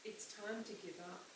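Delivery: soft, hushed and gentle; father gently telling his daughter to give up; real soft voice. A narrator's reading. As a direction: break it to her softly. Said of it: "in a real soft voice".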